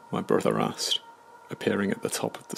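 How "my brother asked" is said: usual speaking voice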